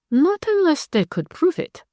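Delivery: usual speaking voice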